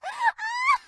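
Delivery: high, loud, near-crying giggle fit; squealing giggle. Acted. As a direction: giggle until it almost turns into a whimper. Fairy being tickled.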